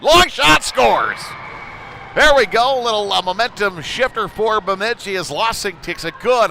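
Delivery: loud, high shout of a goal, then a still-excited recap; goal call. Real, not acted. Announcer calling the goal for Bemidji, loud and high. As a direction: yell the goal, stay fired up.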